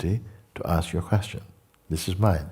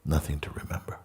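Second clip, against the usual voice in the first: hushed meditation voice with long pauses; guided meditation. Real, not acted. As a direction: guide them into emptiness, leaving long silences.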